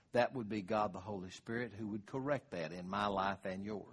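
normal talking voice

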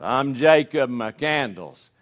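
slow, loud drawn-out cowboy drawl; a gruff Western hero introducing himself; John Wayne impression. Dying gunfighter answers the boy, slow loud cowboy drawl. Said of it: "Remember what Duke said?".

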